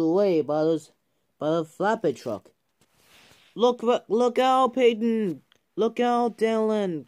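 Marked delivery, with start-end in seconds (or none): 3.5-7.1 high, loud warning cry. A narrator's reading. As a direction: call out an urgent warning. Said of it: "cried Peyton".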